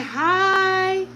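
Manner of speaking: put-on high, sing-song baby-talk "Hi"; lifted mother's baby voice. Shown as a demonstration. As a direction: coax him with a high little hi. Modeling "hi" for the baby.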